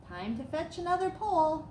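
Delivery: ordinary delivery, nothing marked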